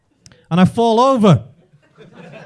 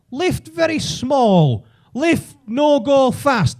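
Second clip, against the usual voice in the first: slow, higher pidgin English; talking down to a foreigner; 5 semitones higher, much slower than usual. Retold or imitated. Man in lift to supposed Spaniard, slow pidgin English. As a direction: spell it out slowly as if for a foreigner. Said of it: "talk to me like that".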